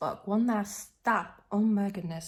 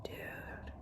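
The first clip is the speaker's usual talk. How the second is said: hushed whisper of dude, no voice at all; whispered aside; 13 dB quieter than usual. Retold or imitated. Watching a shifty guy on the train, whispering dude. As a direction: whisper dude to a fare dodger. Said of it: "I'm like".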